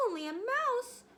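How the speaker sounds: hurt pride, shot up high; indignant. A narrator's reading. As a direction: bristle at the insult. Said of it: "cried the little animal indignantly".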